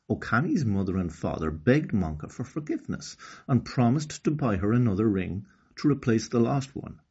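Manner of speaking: usual speaking voice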